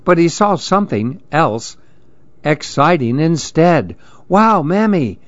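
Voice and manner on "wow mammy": put-on high-pitched boyish wonder; excited exclaiming. A narrator's reading. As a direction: exclaim it like an amazed kid. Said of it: "Andy exclaimed".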